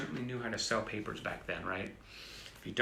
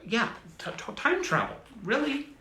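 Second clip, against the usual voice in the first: excited; 9 semitones higher, 3 dB louder than usual. Real, not acted. Excited about time travel.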